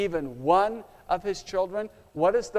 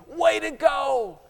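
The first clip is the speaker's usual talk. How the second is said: higher, louder shouted encouragement; cheering crowd shouting; 4 semitones higher, 5 dB louder than usual. Acted. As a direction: cheer him home like a stadium crowd. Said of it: "They start shouting".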